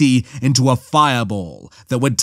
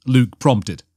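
plain reading voice

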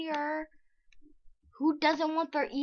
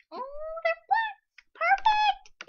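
much higher, louder thrilled squeal; delighted squeal; 8 dB louder, slower than usual. Real, not acted.

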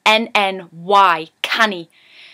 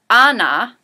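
Anna, called out loud in Geordie; Geordie sentence; 6 dB louder than usual. Shown as a demonstration. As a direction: announce Anna in broad Geordie. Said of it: "I like to use the sentence".

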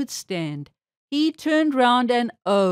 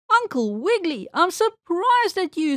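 higher, lightly squeaked reproach; squeaky mouse; 7 semitones higher than usual. A narrator's reading. Mouse teacher reproving the tickling rabbit, lightly squeaky. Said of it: "squeaked the mouse".

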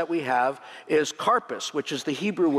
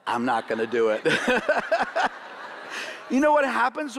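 laughing; 7 semitones higher than usual. Real, not acted. Refuses to eat the horseradish.

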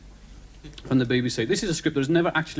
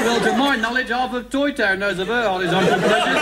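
raised, higher mock children's-story character; gossiping Zebedee; 6 semitones higher, 3 dB louder than usual. Zebedee spreading Toy Town gossip, knowing character voice. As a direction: tell the scandal as a storybook character.

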